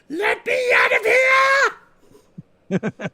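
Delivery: high, strained mock shout; trapped character's hammed-up high yell; Blitzwing screaming. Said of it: "Blitzwing screaming".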